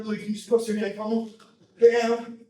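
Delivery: ordinary talk, nothing marked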